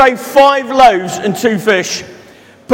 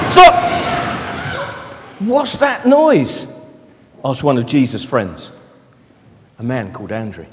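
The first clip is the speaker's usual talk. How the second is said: soft, low storytelling voice; reading a story to children, soft and low; story narration; 10 semitones lower, 5 dB quieter than usual. Acted. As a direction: draw the children in quietly. Reads a Bible story to children.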